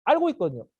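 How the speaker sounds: ordinary talk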